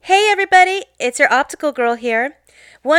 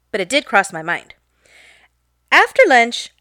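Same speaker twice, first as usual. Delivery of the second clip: ordinary delivery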